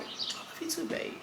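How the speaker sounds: high, hushed, near-whispered wifely reply; Amani. Retold or imitated. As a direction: imitate a wife's soft, understated answer. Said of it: "Amani can just be like".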